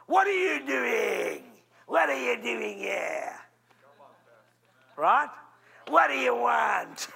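put-on older brothers jeering at David, shouted slow; mocking brothers. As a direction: bark it down at the kid brother. Brothers scolding David, acted.

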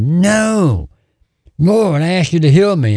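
high-pitched, insistent refusal; retold protest. Dying man refusing the voice and appealing to God, protesting. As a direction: push back firmly, pitched well up. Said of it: "He said".

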